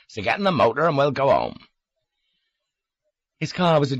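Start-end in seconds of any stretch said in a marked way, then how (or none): 0.1-1.6 lightly raised Devon voice; rustic Devon lilt; broad Devon accent. A narrator's reading. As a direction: give it in country Devon. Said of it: "Charlie still had a Devon accent".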